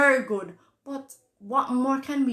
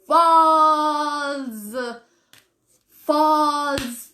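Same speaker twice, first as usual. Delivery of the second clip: emphatic loud dismissal; 3 semitones higher, 6 dB louder than usual. Real, not acted. Dismissing fake news rumour.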